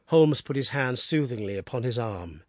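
plain reading voice

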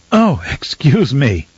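slow, high, flustered apology; startled courtesy, recovering composure. A narrator's reading. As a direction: excuse yourself while regaining your composure. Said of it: "finding his composure".

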